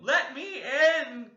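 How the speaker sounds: high, loud, slow wailing plea; a desperate little ghost; wailing ghost child. Acted. Ghost child wailing to be let in, high slow wail. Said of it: "Still it wailed".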